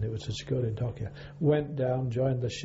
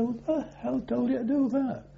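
much higher, mock incredulous demand; indignant officer; 10 semitones higher than usual. Officer demands who ordered the scrubbing, mock indignant. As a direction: imitate an outraged superior in a raised pitch.

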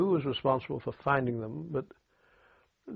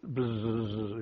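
a buzzing hum for the pedal dynamo; imitated dynamo buzz. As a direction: buzz like a little generator. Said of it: "you know, like that".

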